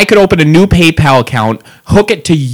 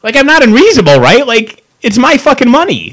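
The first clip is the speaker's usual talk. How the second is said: exasperated anger; 7 semitones higher than usual. Real, not acted. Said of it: "I'm so angry".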